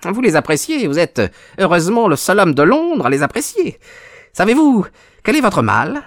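high-pitched, sneering villain. A narrator's reading. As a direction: jeer at the sick man in a high voice. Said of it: "Notre visiteur ricana". (ricanement moqueur, voix aiguë)

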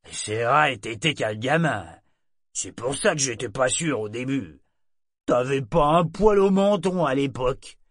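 léger vieux marin, plus fort; matelot bavard. A narrator's reading. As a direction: insiste en riant de souvenir. Tarlock insiste. (matelot qui se rappelle Brachen gamin)

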